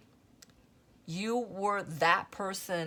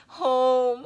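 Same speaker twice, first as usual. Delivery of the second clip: loud, high cry of home, emotion welling up; moved, near tears; 6 semitones higher, 10 dB louder than usual. Real, not acted. Reader overcome while telling viewers about home. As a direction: let the word burst out, close to crying. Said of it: "I'm gonna start crying".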